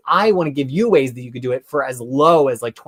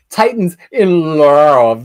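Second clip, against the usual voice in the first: drawn-out, loud, growly relish; gushing title announcement; 9 dB louder, much slower than usual. Real, not acted. Host announcing a comic they adore, gushing. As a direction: stretch it out slowly and big.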